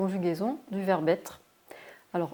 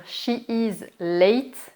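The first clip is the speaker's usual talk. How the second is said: slow demo; 2 semitones higher, much slower than usual. Shown as a demonstration. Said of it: "au lieu de dire".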